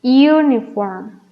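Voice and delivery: Uniform said slowly, starting with a clear Y sound, yoo-niform; slow pronunciation demo. Shown as a demonstration. Teacher showing students the Y sound, slowly. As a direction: stretch out Uniform so the opening yoo is heard. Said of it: "como si estuviéramos utilizando una Y".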